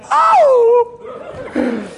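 very high, loud drawn-out "oh"; big comic cry of amazement; imitated wail. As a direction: act out overwhelmed astonishment. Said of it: "I was just like".

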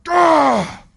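loud high cry of emotion; emotional cry. Retold or imitated. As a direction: let out a cry. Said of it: "Now I'm like".

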